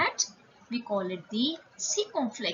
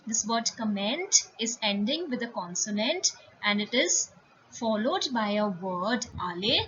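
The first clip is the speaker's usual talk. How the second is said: ordinary delivery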